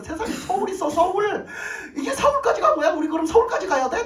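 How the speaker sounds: high, loud astonished cry; shocked disbelief. Retold or imitated. Retelling the family's shock at the hospital being in Seoul, a loud high disbelieving cry. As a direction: blurt it out in sheer disbelief.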